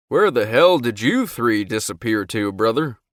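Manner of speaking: loud, playful jab at a brother; teasing. A narrator's reading. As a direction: rib him with a grin. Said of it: "his tone teasing".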